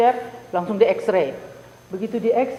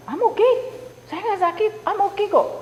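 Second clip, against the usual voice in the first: bright, much higher "I'm okay" to the dentist; cheerful, much higher reply; 9 semitones higher than usual. Retold or imitated. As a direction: chirp back that all is fine, pitched well up. Answering the dentist's phone call. (menjawab dokter gigi di telepon dengan riang dan jauh lebih tinggi)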